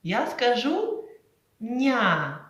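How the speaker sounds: ня: soft н gliding into я. Shown as a demonstration. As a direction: say ня softly, н pressed to the palate. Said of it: "то м'яко".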